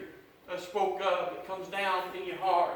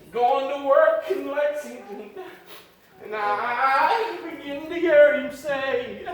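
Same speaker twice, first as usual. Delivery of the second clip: loud, rising preacher's cadence, pushing each phrase; full-force preaching; 4 semitones higher, 7 dB louder than usual. Acted. Preacher exhorting the congregation about salvation, full-force preaching.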